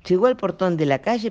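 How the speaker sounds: plain reading voice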